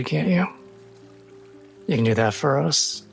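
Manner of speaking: ordinary talk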